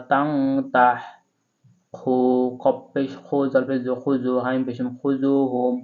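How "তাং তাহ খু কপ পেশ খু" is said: ordinary delivery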